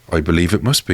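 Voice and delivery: normal talking voice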